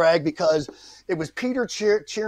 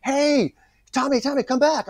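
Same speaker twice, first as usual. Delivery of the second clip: high, urgent retold call; calling after someone; 10 semitones higher than usual. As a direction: call him back across a lawn, pitched up. Casting director runs out calling him.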